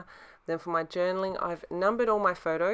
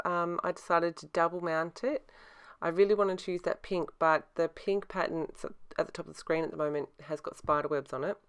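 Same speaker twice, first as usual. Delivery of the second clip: ordinary talk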